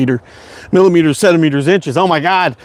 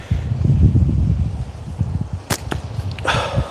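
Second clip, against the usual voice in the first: slow breathy sigh out; long sigh; 7 dB quieter than usual. Real, not acted. Streamer sighing to himself between shots. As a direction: let out a tired sigh.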